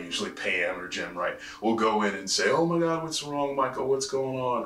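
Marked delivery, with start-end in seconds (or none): 2.5-4.6 high, gushing worried coworker; a coworker fussing over an upset Michael; mock concern. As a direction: overdo the concern for the boss. Coworker checks on sulking boss.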